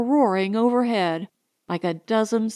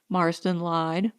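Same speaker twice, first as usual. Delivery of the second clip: plain reading voice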